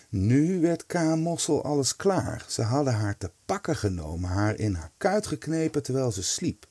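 ordinary delivery, nothing marked

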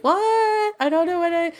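lightly voiced puzzled ditz; mock confusion. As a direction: play the clueless girl. Playing confused girl.

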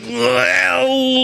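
long, very high held moan; comic moan. Acted. Caller hearing the specials recited, comic high moan. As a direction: let out a drawn-out high wail. Imitating himself during pizza call.

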